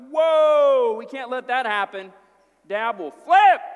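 loud, high panicked cry; alarmed shout. Retold or imitated. Brothers panicking as Benjamin is taken, loud alarmed. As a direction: blurt it out in alarm.

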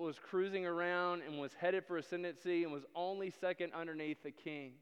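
normal talking voice

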